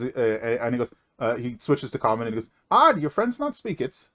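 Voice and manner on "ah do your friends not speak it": smiling dwarf merchant. Acted.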